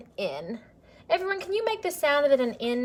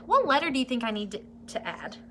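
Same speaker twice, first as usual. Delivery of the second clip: ordinary talk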